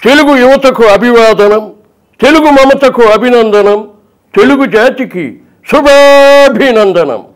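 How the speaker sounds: loud, slow, grand political oration; NTR. Shown as a demonstration. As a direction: declaim every line like a stage hero. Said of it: "NTR గారి voice".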